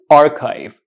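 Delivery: archive said as AR-kyve; correct archive. Shown as a demonstration. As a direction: say "archive" with a K and long I, rhyming with five. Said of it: "Pronounce it".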